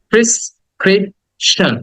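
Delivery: slow "prescription", pris, krip, shan; syllable by syllable. Shown as a demonstration. Teacher drilling prescription with students, slow syllables. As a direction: leave gaps inside "prescription".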